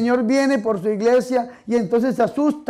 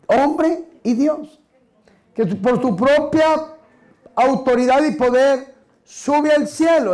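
solemn proclamation; 3 semitones higher, slower than usual. Acted. Preacher proclaims the ascension.

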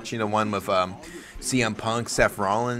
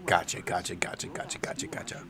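fading to a whisper; 4 semitones lower, 9 dB quieter than usual. Real, not acted. Fading repeated gotchas.

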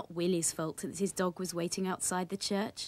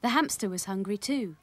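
usual speaking voice

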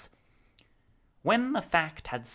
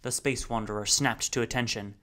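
plain reading voice

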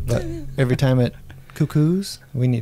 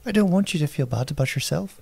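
mock Irish lilt with a rising end; Irish accent; 2 semitones higher than usual. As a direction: play it as a put-on Irish speaker. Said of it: "No, that's Irish".

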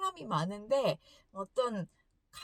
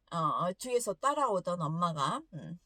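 ordinary delivery

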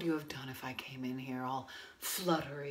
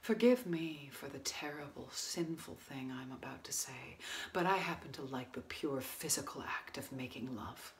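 quiet bitterness; 2 semitones lower, 8 dB quieter than usual. Acted. Woman confronts restaurant owner after failed affair, quietly bitter. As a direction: confront him softly, with long pauses.

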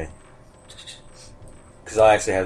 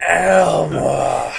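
ghostly wail; 6 semitones higher, 5 dB louder than usual. Pretend. Repeats ghost call.

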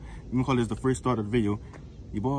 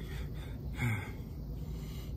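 long, soft exhale; hurting sigh; 15 dB quieter than usual. Real, not acted. Vlogger after push-ups that hurt, pained sigh. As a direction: blow out a breath after exertion. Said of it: "That hurt".

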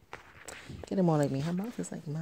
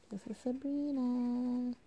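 tender introduction; 4 semitones higher than usual. Real, not acted. Showing the baby to camera.

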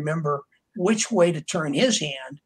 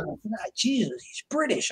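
much higher, slow, softer groan of disbelief; mock dismay; 6 semitones higher, 4 dB quieter than usual. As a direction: sigh it out like the casting news is a disaster. Retelling his own dismayed reaction.

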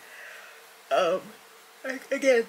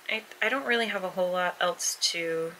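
ordinary delivery

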